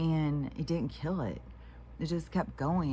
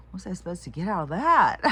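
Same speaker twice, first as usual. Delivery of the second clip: exasperated outburst; 5 semitones higher, 8 dB louder, faster than usual. Retold or imitated. Stunned on waking from a dream.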